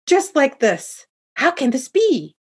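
high-pitched, astonished father; shocked disbelief. A narrator's reading. Yelling father, shocked by the reveal.

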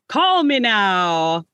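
slow fake Jamaican drawl; Miss Cleo impression. Fan mocking Miss Cleo's TV ad in her fake accent. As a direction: stretch it out like a TV psychic ad. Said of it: "you mentioned Miss Cleo".

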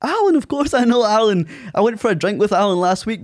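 high, mock-enthusiastic acted reply; playing along. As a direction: pretend you know him well, pitched right up. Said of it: "just to play along with it".

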